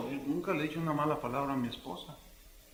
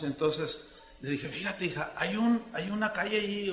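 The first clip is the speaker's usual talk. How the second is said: usual speaking voice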